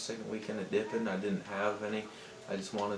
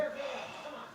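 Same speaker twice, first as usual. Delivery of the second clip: soft exasperated breath out; officer's tired sigh at the boys; weary sigh; 8 dB quieter than usual. Retold or imitated. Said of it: "the officer was like".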